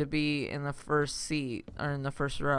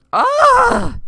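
sudden high loud scream; high startled shriek; shocked scream; 15 dB louder than usual. Real, not acted. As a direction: yelp in shock as the torch breaks. Torch breaks.

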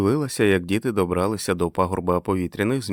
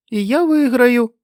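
very high, loud, drawn-out cry; high-pitched childish yell; little girl's shout; 8 dB louder than usual. Acted. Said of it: "кричала Мег".

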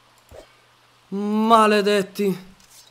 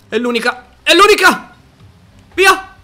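much higher, much louder frantic shouts; panicked shouting; 11 semitones higher, 10 dB louder than usual. Real, not acted. Gamer fleeing monsters toward the waterfall, high frantic yells. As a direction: yell as you flee for your life.

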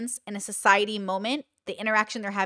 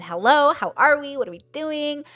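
higher-pitched, chirpy greeting; bubbly; 4 semitones higher, 4 dB louder than usual. Acted. Showing her normal disposition to viewers, bubbly. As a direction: greet everyone with bubbly energy. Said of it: "like, bubbly and".

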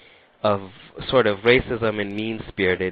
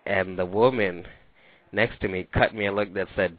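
usual speaking voice

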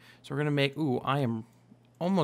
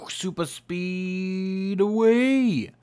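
high, long-drawn call building louder; playful whoosh cry; 5 semitones higher, 3 dB louder, much slower than usual. Pretend. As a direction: stretch it out like a launch, rising to a peak. Playfully launches off swimming fast.

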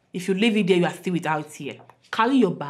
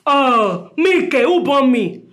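louder, higher, slow exasperation; exasperated outburst; 5 semitones higher, 6 dB louder, slower than usual. Acted. Receptionist exasperated by the customer, drawn-out and loud. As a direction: throw up your hands in disbelief.

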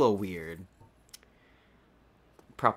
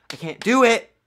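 "I can't do it" cried out high and loud; exasperated wail; 10 semitones higher, 8 dB louder than usual. Real, not acted. Gamer giving up on the boss, wailing "I can't do it". Defeated repeat.

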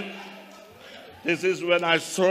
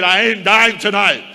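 laughing defiance; 3 semitones higher, 8 dB louder than usual. Retold or imitated. Defiant retort to wife.

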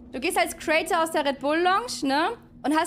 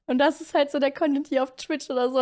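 normal talking voice

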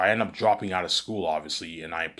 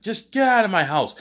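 much higher, louder furious shout; angry shout; 12 semitones higher, 7 dB louder than usual. Acted. Father repeats the order to leave, high furious shout. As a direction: order him out at the top of the voice.

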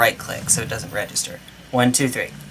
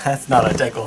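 ordinary delivery

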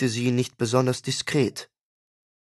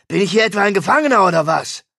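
higher, louder and fast; indignant outburst; 7 semitones higher, 7 dB louder, faster than usual. Acted. Star protesting he is a prisoner, fast and indignant. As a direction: spit out the protest. Mosch protests being confined.

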